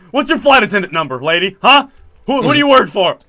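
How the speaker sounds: hammed-up confrontation, firing questions at her; mock interrogation. Joking confrontation of flight attendant.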